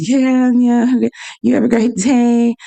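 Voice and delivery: fawning over the customer ahead; mock cheery. The cashier chatting up the customers ahead, mock cheery. Mimics staff chatty with others.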